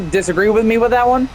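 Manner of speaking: talking through hiccups; hiccuping between words. Real, not acted. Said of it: "sorry, I got the hiccups".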